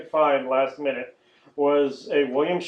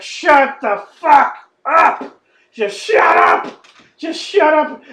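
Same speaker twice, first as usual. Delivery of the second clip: loud, high, slow shout; bored viewer yelling at the chatty characters on screen; exasperated yell; 12 semitones higher, 8 dB louder, much slower than usual. Retold or imitated. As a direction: yell at them to shut up.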